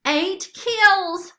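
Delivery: high, loud cheer; gleeful shout; victory cheer. Acted. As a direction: crow over the kill count.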